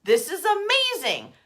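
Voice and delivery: a touch of slow stunned awe, rising; amazed. Pretend. You as things align, mildly amazed. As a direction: let the wonder hit you. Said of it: "you just go".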